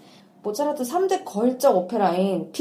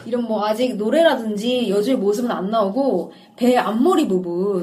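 ordinary delivery

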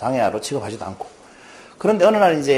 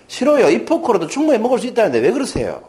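put-on high, loud child's protest without real sobbing; imitated tearful protest; 8 semitones higher, 3 dB louder than usual. Daughter refusing to give up her fork, mock tearful protest. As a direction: protest like an upset child, acted. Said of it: "갑자기 펑펑 울면서 이렇게 얘기하는 거예요".

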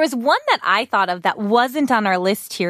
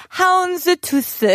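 higher "houndstooth" with Korean extra vowels; Korean accent; 8 semitones higher than usual. Retold or imitated. Echoing co-host in a hard lesson, playful Korean accent. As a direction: imitate the Korean-accented "houndstooth", high and amused. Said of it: "pronounce it in a Korean accent".

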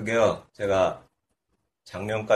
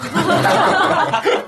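high loud laugh; high burst of laughter; 10 dB louder than usual. Real, not acted.

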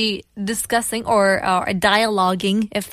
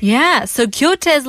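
normal talking voice

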